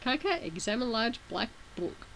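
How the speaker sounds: plain reading voice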